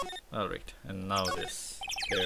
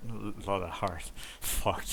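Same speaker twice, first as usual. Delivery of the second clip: stumbling into real laughter; amused exasperation breaking into a laugh; cracking up; slower than usual. Real, not acted. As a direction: stammer the words, then lose it laughing. Player cracks up at the pun name.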